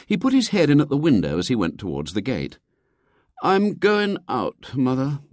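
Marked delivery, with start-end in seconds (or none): 3.4-5.2 put-on slow, much higher, mournful boy; stern sorrow. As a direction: drag it out like a wounded martyr. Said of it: "stern sorrow rather than anger".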